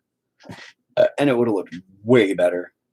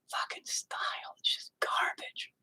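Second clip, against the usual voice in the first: whispered under his breath, fed up; disgusted whisper; 13 dB quieter than usual. Real, not acted. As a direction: mutter it to yourself in a whisper, disgusted. Disgusted with his own painting.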